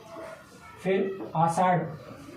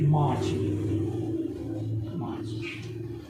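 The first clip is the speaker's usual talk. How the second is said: quiet; 3 semitones lower, 5 dB quieter, much slower than usual. Shown as a demonstration. Teacher answers own question quietly.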